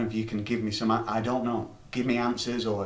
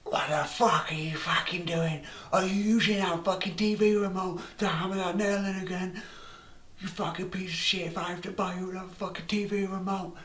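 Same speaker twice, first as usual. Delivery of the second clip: high, shrill-pitched mock rant, faster at the end; furious mum; 8 semitones higher than usual. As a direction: tear into the kid like an enraged mum. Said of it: "she was like".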